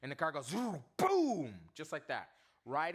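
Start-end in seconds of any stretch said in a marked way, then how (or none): 1.0-1.5 loud high boom; crash sound. Retold or imitated. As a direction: punch out the crash like a sound effect. Crash sound effect in story.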